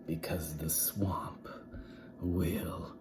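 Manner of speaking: unvoiced, low threatening whisper; menacing whisper. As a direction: warn them in a sinister hush. Witch threatens class.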